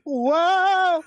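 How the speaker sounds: high, loud falling yelp of surprise; mock whoa. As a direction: yelp like someone slipping. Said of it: "it was like".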